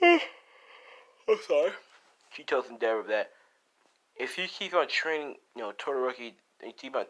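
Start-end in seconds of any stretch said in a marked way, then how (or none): 0.0-1.7 high, slow apology opening on a sigh. Acted. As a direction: sigh it out like a worn-down mother giving in. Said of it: "she tells him".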